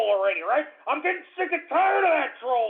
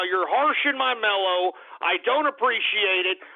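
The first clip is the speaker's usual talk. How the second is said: normal talking voice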